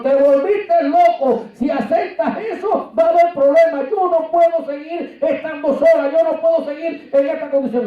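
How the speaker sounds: lightly voiced upset wife; wife objecting. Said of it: "Y me dijo".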